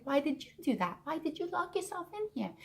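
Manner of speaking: high, distraught reenacted plea; near meltdown. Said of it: "on the brink of a meltdown".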